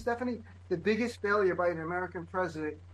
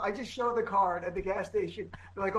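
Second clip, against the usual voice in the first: normal talking voice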